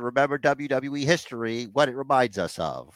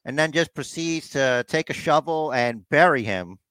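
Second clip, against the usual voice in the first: normal talking voice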